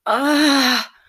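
a sharp cry of pleasure; loud moan. Acted. Lover crying out in pleasure, loud high moan.

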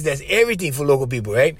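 usual speaking voice

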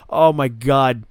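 ordinary talk

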